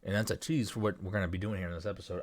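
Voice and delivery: talking around ice cube; ice in mouth. Real, not acted. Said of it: "I don't know why I put ice in my mouth while I'm talking".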